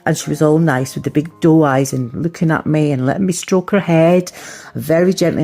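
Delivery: ordinary talk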